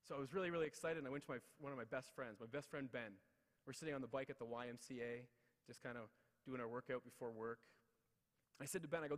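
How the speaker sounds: usual speaking voice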